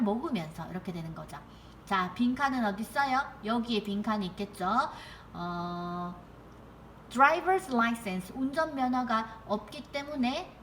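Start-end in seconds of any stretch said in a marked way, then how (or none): none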